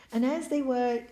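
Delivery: ordinary delivery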